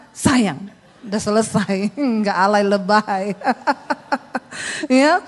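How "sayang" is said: "Sayang" said briefly and loud, no stretching; short endearment. Shown as a demonstration. Husband stroking short hair, a short "Sayang". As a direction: cut "Sayang" down to one quick stroke. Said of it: "Kalau gini kan cuma".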